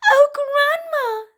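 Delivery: put-on high, slow little girl.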